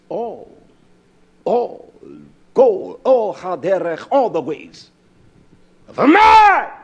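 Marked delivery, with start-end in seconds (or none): 5.9-6.7 high, loud shouted climax; sermon peak yell; preacher's shout. Acted. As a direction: shout it to the rafters.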